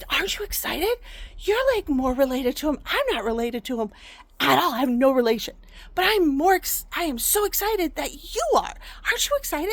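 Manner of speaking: high, loud, incredulous pleading; animated retold excitement, punching the key words; imitating excited dad. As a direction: act out an excited dad badgering his wife. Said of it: "I would get so excited about it".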